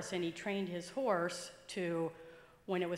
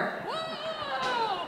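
startled whoa. Retold or imitated. Horse goes over the cliff.

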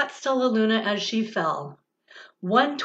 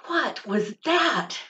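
slow, lifted question from a startled chick; a hint of a baby bird's alarm; 4 semitones higher, slower than usual. Baby bird startled by the bat, slightly higher and slow. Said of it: "cried Flap".

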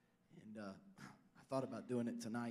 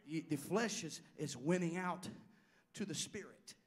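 higher, louder, full-force preaching; pitched up and loud, at full force; preaching climax; 5 semitones higher, 5 dB louder, slower than usual. Real, not acted. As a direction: shout it to the church like a climax.

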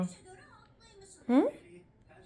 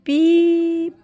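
high, loud, long beep; playful beep; 7 semitones higher, 9 dB louder than usual. Pretend. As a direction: burst into a drawn-out high honk.

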